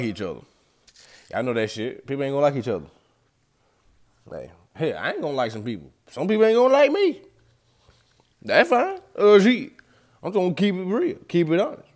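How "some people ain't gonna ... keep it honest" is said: higher and louder, owning it; defiant declaration. Real, not acted. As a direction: proclaim it proudly to everyone. Defiant declaration about people.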